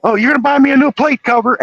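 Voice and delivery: high, loud mock yell of a crazed brother; hammed-up high cackling villain voice; yelling movie character. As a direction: shriek it like a deranged movie killer. Retelling Chop Top yelling.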